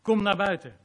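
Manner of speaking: Jesus calling loudly into the tomb. Acted. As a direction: call him out of the tomb. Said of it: "riep hij met luide stem".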